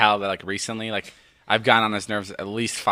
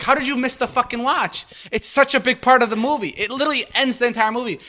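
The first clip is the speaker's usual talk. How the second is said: higher, disbelieving tirade; incredulous rant; 12 semitones higher than usual. Retold or imitated. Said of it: "I got so mad at him".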